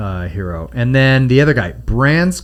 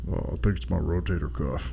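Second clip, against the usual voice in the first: deep, much softer; low gruff voice; 7 semitones lower, 11 dB quieter than usual. Retold or imitated. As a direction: say it low and hushed. Low quiet parody.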